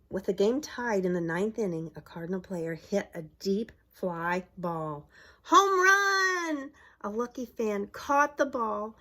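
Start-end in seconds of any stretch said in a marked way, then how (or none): 5.4-6.7 high, loud thrilled shout; excited home run call. Acted. Crowd sees the winning hit, loud thrilled shout. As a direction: yell it as the ball flies out. Deep fly ball in ninth.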